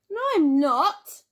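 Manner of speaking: loud, high protest; indignant shout. A narrator's reading. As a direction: shout it back. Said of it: "shouted Crispin".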